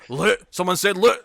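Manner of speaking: lightly voiced excited yell of loot; mock gruff wake-up. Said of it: "Mick just wakes up and goes".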